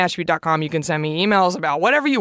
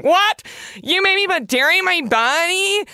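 higher, indignant mock outrage; outraged listener; 9 semitones higher than usual. A fan spitting out kombucha at her, in high mock outrage. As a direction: play the horrified vegan fan. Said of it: "spitting out their kombucha going".

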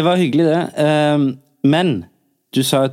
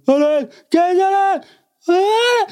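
high yelled heckle; imitating a rowdy audience member calling down; drunk heckler shouting. Mocking the drunk crowd shouting down to Tore. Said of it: "folk som ropte sånn".

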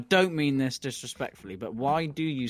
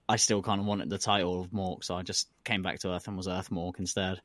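ordinary delivery

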